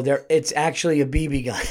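ordinary talk, nothing marked

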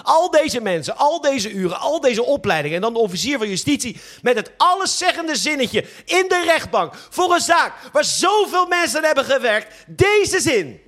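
building outrage. Acted. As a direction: build from indignant to shouting. Building outrage over wasted effort.

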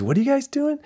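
high-pitched questioning quote; imitated curious lieutenant. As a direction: ask the visitors, voice lifted high.

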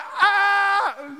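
long high mock scream of rage; re-enacted drawn-out yell; acted angry yell. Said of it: "I had this anger".

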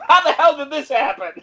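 high-pitched, amused mock disbelief; mock bafflement on the edge of laughter; baffled dialect coach. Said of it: "the dialect coach was just like".

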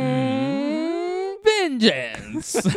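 long strained hum bursting into loud "vengeance"; high, drawn-out, hammed-up orc cry; one-word orc. Imitating an orc who says one word, theatrical menace. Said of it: "something, something, one word".

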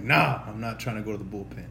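imitating the pitcher's low dismissive refusal. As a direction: shrug it off as the pitcher. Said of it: "He was just like".

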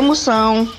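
ordinary delivery

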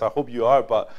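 usual speaking voice

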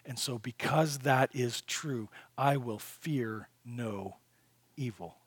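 soft, intimate voice, as if praying alone; quiet prayer. Pretend. Believer voicing a prayer to God in the valley, soft and hushed. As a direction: pray it low, close and trusting.

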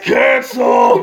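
mock shout. Mock cancelling the podcast.

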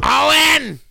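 loud high yell of a name; screaming a name. Retold or imitated. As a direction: yell for the cat across the house. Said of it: "Are you screaming Owen?".

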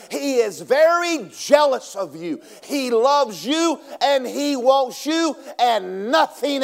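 loud, rising pulpit preaching, drawn out; full-force preaching. Acted. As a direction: hammer the point home to the church.

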